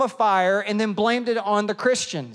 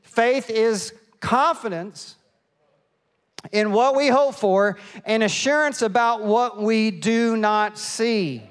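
scripture reading; 3 semitones higher, slower than usual. Acted. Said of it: "It says".

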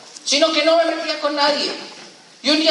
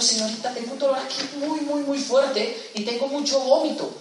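weak pained plea; 6 dB quieter than usual. Retold or imitated. Sick man begging the nurse for help, weak and pained. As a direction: plead weakly as if barely standing.

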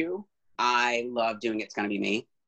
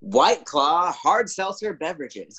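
ad announcer voice; 6 semitones higher than usual. Pretend. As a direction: sell it like a seltzer ad. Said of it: "our show today is sponsored by".

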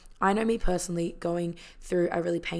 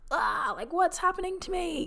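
high-pitched imitation of a panicked cry; hammed-up wail of alarm, pitched way up; mock freak-out; 11 semitones higher than usual. Retelling the Grinch suddenly feeling emotions, mock freak-out. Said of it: "he's feeling and he's freaking out".

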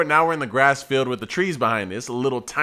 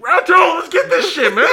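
loud, high hype shout; instigating yell; 7 dB louder than usual. Retold or imitated. Instigator calling for a second round, yelling. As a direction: reenact egging them on from the crowd. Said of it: "like, yell out ambiguously".

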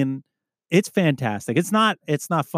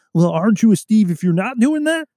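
higher, put-on heckling voice; mocked officer fans; 8 semitones higher, 4 dB louder than usual. Officer fans challenging the hosts, mocking imitation. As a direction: imitate a nitpicker, pitched right up. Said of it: "they're gonna be like".

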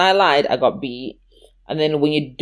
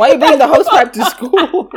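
much higher and louder, laughing through it; incredulous laughing outburst, higher and louder; laughing disbelief; 12 semitones higher, 9 dB louder than usual. Real, not acted. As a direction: ask it while cracking up. Laughing at David's gift.

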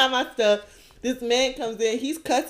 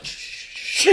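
hush; 8 dB quieter than usual. Retold or imitated. Man hushes her.

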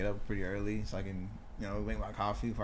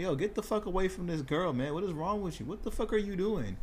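retold outrage, pitched high without extra volume; indignant confrontation; 7 semitones higher than usual. Retelling stepping in between a man and a girl, high indignant protest. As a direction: imitate warning someone off, high and incensed.

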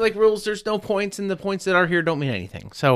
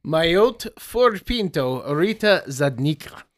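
put-on Russian accent; 2 semitones higher, slower than usual. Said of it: "my Russian accent is used".

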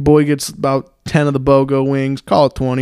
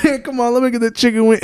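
higher, quick wheedle; imitated pleading man; 6 semitones higher, faster than usual. Man asks a diner for his chicken wing, imitated pleading. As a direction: put on a man begging for food. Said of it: "And he's like".

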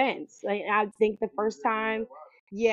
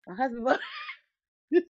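breaking into a laugh mid-line, higher and fast; 6 semitones higher, faster than usual. Real, not acted. Repeating her stock answer to fans until she cracks up laughing. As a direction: laugh through "My husband bought it".

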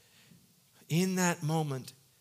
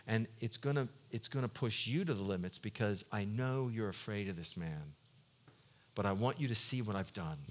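gentle reassuring God; 6 semitones lower, 5 dB quieter than usual. Retold or imitated. God speaking gently to Ananias.

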